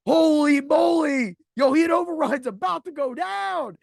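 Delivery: slow, loud, high mock amazement; class gasping. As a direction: play the stunned classmates. Whole class reacts to fight.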